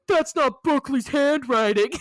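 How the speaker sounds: loud, high put-on character voice; mock yell in a cartoonish voice; imitated outburst. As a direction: blurt it out as a hammed-up protest. Bill blurts out the truth.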